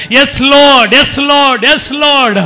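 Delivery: loud fervent cry of Yes Lord; full-voiced shout of praise. Shown as a demonstration. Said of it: "యేసయ్యను గట్టిగా పిలుస్తూ".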